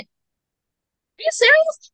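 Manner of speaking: high, slow, drawn-out disbelieving squeal; stunned disbelief. Retold or imitated. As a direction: let the question climb in shock at the price. Stunned by the soldier's offer.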